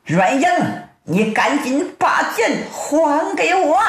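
rising, insistent shouted demand; storyteller's acted shout; calling out loud. A narrator's reading. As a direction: demand it back with climbing pitch. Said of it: "葛坤高声喊道".

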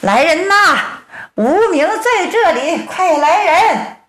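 音调拔高、拖长尾音的呼喊; 扯开嗓子喊人来帮忙; 呼救喊叫. A narrator's reading. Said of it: "唐铁牛喊上了". (高声呼救)

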